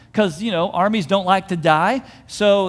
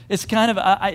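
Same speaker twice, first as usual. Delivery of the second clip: usual speaking voice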